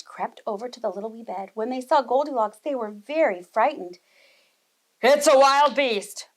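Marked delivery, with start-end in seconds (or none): 5.0-6.3 louder, slow, growling papa bear. Acted. Papa Bear warns of the beast, slow loud growl. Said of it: "Papa Bear growled".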